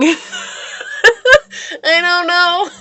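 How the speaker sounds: high, breathless giggling words; laughing. Real, not acted. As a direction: laugh it out, embarrassed. Laughing at her own odd word.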